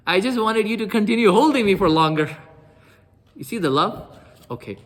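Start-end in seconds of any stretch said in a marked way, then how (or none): 0.1-2.4 high, loud, heartfelt plea; fervent confession. Retold or imitated. Bilal to the Prophet who held him, fervent confession. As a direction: imitate an adoring confession, voice raised.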